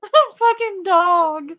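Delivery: high, loud, squeaking through laughter; words breaking into laughs; laughing hard. Real, not acted. Pointing out the dog in the clip, laughing hard.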